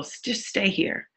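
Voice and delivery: soft, slow and hushed; tender plea. Shown as a demonstration. Therapist softly urging a hurting person to stay. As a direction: gently coax them to stay. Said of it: "I tell these people".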